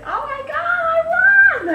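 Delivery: very high-pitched victory squeal; excited scream. Retold or imitated. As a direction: re-enact screaming the big news down the phone. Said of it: "Like that, I was screaming".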